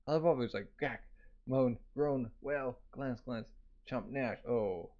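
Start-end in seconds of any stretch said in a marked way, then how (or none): none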